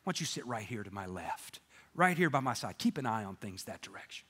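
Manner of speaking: God pleased with the dog, low and hushed; low, quiet, easy approval. Acted. God seating the German Shepherd beside him, low and quiet. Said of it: "And God goes".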